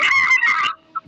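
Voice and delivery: very high loud laughing shriek. Real, not acted.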